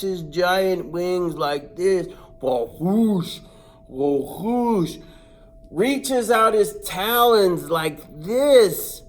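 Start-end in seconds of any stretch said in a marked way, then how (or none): 2.4-5.0 wing flaps. Retold or imitated. Wings flapping sound.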